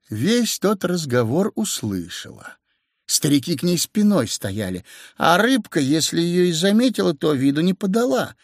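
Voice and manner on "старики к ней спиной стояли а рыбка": ordinary delivery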